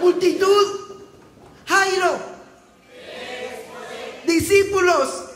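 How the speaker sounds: high, loud called names; preacher cueing groups in church, calling loud; shouted cue calls. Real, not acted. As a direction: belt each name out to the room. Calling out cues to congregation.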